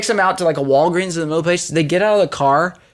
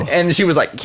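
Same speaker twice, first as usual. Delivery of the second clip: ordinary talk, nothing marked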